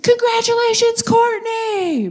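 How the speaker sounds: high, bright cheer; cheering crowd shout. Retold or imitated. Comic at a show leading a cheer for Courtney, excited. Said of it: "and I went".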